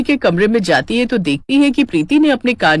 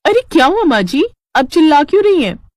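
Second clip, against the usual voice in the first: startled; 7 semitones higher than usual. Acted. Woken by yelling mother-in-law.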